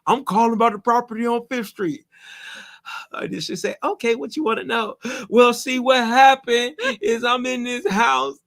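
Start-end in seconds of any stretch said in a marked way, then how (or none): 0.1-2.0 higher put-on voice of a caller; mock caller voice. Retelling a rental inquiry, mock caller voice. 3.8-8.4 higher, slow whiny telling of troubles; mock sob-story caller. Landlord imitating a tenant's sob story, higher and slow.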